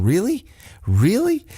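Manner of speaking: high, sharply rising disbelief; mock incredulous. Sammy on the apron questioning his partner, mock incredulous. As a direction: imitate someone asking in utter disbelief.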